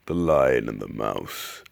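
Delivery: put-on old-man narrator, projected well above the chat. Reader starts the next fable, old man narrator voice. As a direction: announce the title like an old storyteller. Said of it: "let me get into my old man voice".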